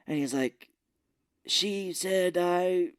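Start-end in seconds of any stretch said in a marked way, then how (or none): 1.5-2.9 higher, slow hammed-up villain; campy villain quoting his mother; mock villain voice. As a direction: drag out the quote like a ham villain.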